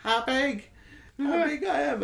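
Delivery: put-on very high, squeaky toddler voice; little child. Imitating the child in the trailer's message, very high baby talk. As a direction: pipe up like a tiny kid. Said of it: "it's like a little baby talking".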